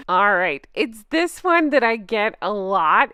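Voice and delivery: normal talking voice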